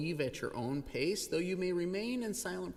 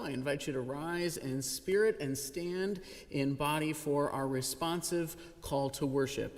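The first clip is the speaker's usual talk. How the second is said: ordinary talk, nothing marked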